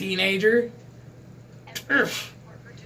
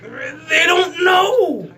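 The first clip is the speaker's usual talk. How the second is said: slow, louder, higher shout; outraged shout; 7 semitones higher, 4 dB louder, much slower than usual. Acted. Vlogger raging that new fans don't know the Borg, slow shout. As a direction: belt it out in disbelief.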